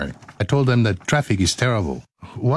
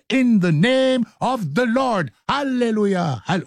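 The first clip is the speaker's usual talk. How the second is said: preacher voice; 9 semitones higher than usual. Pretend. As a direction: call it out like a gospel preacher. Preacher-style crunk outro.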